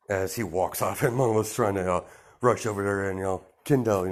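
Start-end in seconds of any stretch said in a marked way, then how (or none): none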